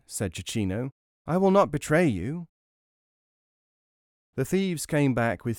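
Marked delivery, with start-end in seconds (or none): 1.3-2.5 put-on high-pitched small boy voice; storybook child, pitched up; little boy. A narrator's reading. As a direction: reassure them as a tiny child. Tiny boy promises the thieves.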